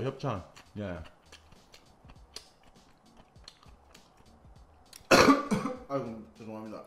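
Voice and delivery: choked throat mid-meal; strained voice after coughing; coughing while eating. Real, not acted. Seen 0: Leans over, head down, hand near mouth; later says throat choked.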